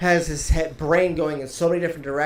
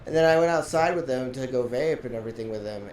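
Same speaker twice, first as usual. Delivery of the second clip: usual speaking voice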